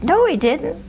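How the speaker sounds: put-on mildly incredulous young girl's objection; retold protest. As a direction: act out contradicting the clerk. Retold denial to courthouse clerk.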